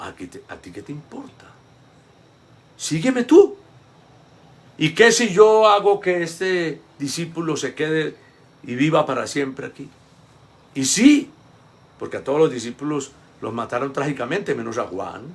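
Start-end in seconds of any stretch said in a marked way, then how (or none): 0.0-9.9 Jesus rebuking. Retold or imitated. Jesus brushes off Peter's question about John, in a loud mock rebuke. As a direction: play Jesus snapping back at Peter. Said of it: "Pero es como".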